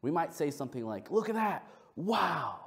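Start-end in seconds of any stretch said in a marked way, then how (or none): 1.1-2.6 amazed. Shown as a demonstration. Demonstrates the code word of amazement.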